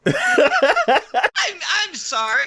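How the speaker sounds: loud, high mock disbelief; incredulous outburst. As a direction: burst out as if you misheard. Acting out disbelief at plot twist.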